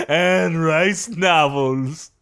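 very slow closing slogan with a lift at the end; slow ad tagline. Acted. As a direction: let the tagline hang. Mock ad for Anne Rice.

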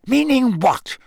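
high, indignant demand; sharp challenge. A narrator's reading. Snape demanding what Moody means, sharp challenge. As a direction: throw it back with pitch leaping.

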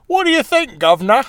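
high-pitched mock British accent; hammed-up British "guv'nor" accent. Shown as a demonstration. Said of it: "If you gave her an accent".

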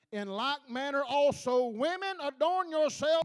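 slow, deliberate scripture reading. Real, not acted. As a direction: read each word slowly, letting it land. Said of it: "I'm gonna slow down".

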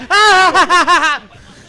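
high loud burst of laughter. Real, not acted. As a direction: crack up loudly. Laugh after shouted catchphrase.

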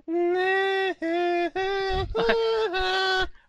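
long high giggle at own joke; high long laugh. Real, not acted. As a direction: laugh helplessly.

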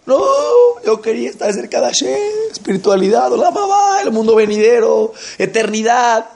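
Esau sobbing, voice pitched high and loud; crying. Acted. Esau cries to his father over the lost blessing, sobbing high. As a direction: wail the words through tears.

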